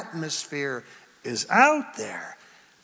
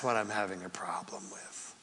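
whispered aside; 10 semitones lower, 15 dB quieter than usual. Real, not acted.

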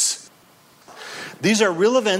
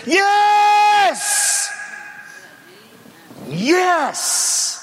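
triumphant shout; 13 semitones higher than usual. Real, not acted. Preacher answering the enemy's taunt, triumphant shout. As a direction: proclaim the yes at full force.

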